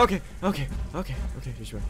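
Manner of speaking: ordinary talk, nothing marked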